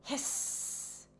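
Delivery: breathy ghostly hiss; soft eerie hiss; spooky hiss. A narrator's reading. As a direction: hiss like something unseen in the dark.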